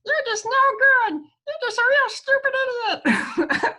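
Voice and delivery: very high squeaky cartoon voice. Shown as a demonstration. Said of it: "repeat the self-critical message in a cartoon voice".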